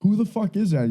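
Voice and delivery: high incredulous quote. Retold or imitated. Retelling his call to dad.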